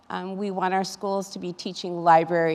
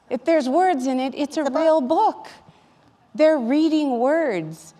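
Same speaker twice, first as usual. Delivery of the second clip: higher and louder, pressing each point home; passionate insistence; 7 semitones higher, 4 dB louder than usual. Real, not acted. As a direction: insist hard that comics are real books, slowing at the end. Passionate advocacy for student reading.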